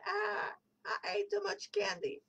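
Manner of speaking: high whiny mock lament over the candy; mock whining regret. Viewer groaning about Halloween candy on Monday, mock whine. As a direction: whimper it like a guilty kid.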